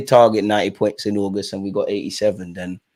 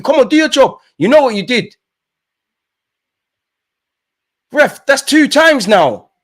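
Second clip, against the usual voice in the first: loud, high ranting at the referee; angry at the ref; 11 semitones higher, 6 dB louder than usual. Real, not acted. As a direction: shout down the ref in frustration. Fan berating the referee.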